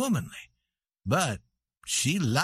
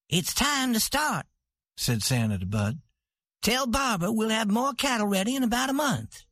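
proud ranch queen; 7 semitones higher than usual. A narrator's reading. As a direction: close the matter with calm authority. Santa dismisses the dispute.

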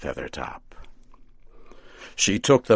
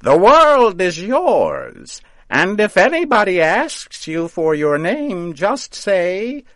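cackling witch farewell; 12 semitones higher, 10 dB louder than usual. A narrator's reading. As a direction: send him off laughing at him.

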